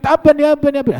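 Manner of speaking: high, loud panicked cry; acted panic, pitch way up; shrieking in alarm. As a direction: cry out as if horrified by the sight. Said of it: "sampai dia ngejerit".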